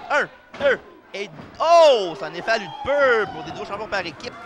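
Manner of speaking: rising excited count. Acted. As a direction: call the count, then burst out. Count and near-fall call.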